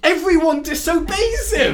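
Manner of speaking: high, loud outburst of disbelief; exasperated shout. Real, not acted. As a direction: yell the complaint in protest. Exasperated complaint about the film.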